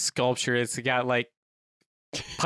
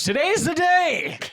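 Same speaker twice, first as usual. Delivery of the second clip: high, drawn-out cheer; excited shout; 12 semitones higher, 6 dB louder, slower than usual. Acted. Waking up to the alarm on the big day, excited shout. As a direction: belt it out slowly and high.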